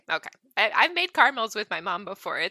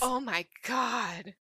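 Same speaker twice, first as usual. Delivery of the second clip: shocked; 5 dB quieter, much slower than usual. Real, not acted. Reacting to poison caramels.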